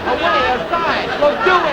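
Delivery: excited shouting guesses. Real, not acted. Contestant shouting guesses at teammate, loud and excited. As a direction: shout the answers at your partner.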